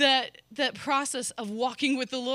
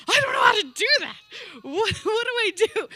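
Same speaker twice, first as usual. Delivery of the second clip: much higher and louder, fast, half laughing half crying; desperate outcry; 11 semitones higher, 10 dB louder than usual. Retold or imitated. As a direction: blurt it out, panicked and near tears. Overwhelmed crying out to the Lord.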